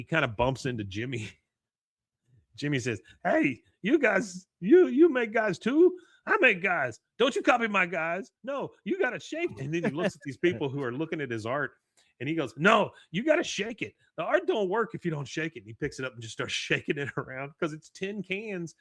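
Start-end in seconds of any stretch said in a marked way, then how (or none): 3.3-9.5 loud, high-pitched character outburst; excitable Jimmy. Retold or imitated. Acting Jimmy in cartoon retelling. 12.6-15.6 high, loud character voice; insistent Jimmy. Retold or imitated. Jimmy lecturing gallery people.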